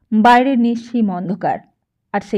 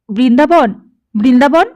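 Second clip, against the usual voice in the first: loud, higher desperate call; 3 semitones higher, 6 dB louder than usual. A narrator's reading. Bound captive calls for his servant, loud desperate cry. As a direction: call out with all your strength. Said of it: "প্রাণপণ শক্তিতে ডাকে".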